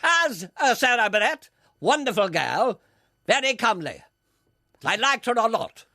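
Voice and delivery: high, declaimed announcer voice; Peter Dixon. Acted. Spoof announcer boasting to the interviewer, in a booming announcer voice. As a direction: announce each remark in separate bursts. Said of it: "Take this encounter with X Factor announcer Peter Dixon".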